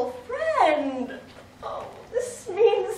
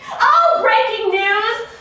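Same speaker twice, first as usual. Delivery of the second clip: high, loud, slow mock announcement; squealing big announcement, slowed down; breaking news; 5 semitones higher, 6 dB louder, slower than usual. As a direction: trumpet it like a headline. Announces her big plan.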